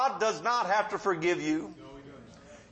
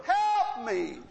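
higher mock yell for help; retold cry for help; 5 semitones higher than usual. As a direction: imitate her calling out for help. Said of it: "So she starts screaming".

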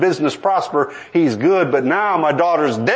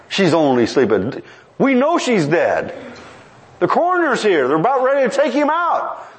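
lightly voiced scoffing crowd, pitched higher; a hint of mourners ridiculing him; mocking mourners; 8 semitones higher than usual. Mourners scoffing at Jesus for saying she sleeps, lightly mocking. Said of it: "they were mocking him".

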